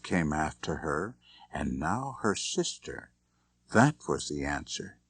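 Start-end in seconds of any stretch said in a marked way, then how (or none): none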